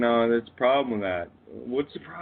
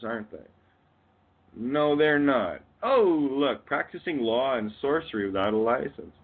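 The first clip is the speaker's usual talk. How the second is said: ordinary delivery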